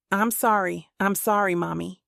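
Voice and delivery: ordinary delivery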